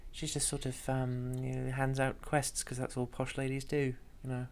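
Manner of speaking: soft, high and refined, barely projecting; posh lady. Shown as a demonstration. Demonstrating the posh lady voice to the other player, quiet and high. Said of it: "'Cause she's so quiet".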